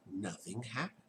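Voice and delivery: ordinary delivery, nothing marked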